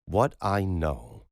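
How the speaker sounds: a doctor setting the record straight; slow, weighty opening of a rebuttal; stern doctor. Acted. Roman's stern pronouncement.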